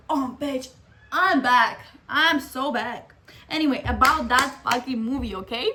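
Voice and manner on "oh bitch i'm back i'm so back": loud, triumphant announcement, slowing on so back; crowing that she's herself again. Retold or imitated. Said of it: "I'm like".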